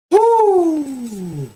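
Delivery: high, drawn-out whoop; excited whoop. Real, not acted. Host whooping after laughing at Vern, excited whoop. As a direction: let out a happy cheer.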